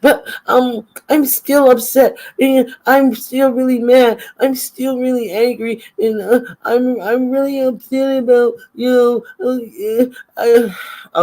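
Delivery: high, loud whiny mimicry of her; hammed-up high-pitched whining; mocking whine. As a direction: whine it like a sulking child. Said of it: "so she says".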